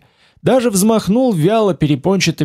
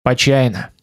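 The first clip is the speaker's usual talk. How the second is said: displeased; 3 semitones lower than usual. Acted. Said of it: "Олег сказал с неудовольствием".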